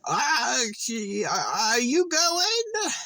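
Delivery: slow confused boomer. Retold or imitated. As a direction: stretch it out like a dithering old boomer driver. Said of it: "But they're like".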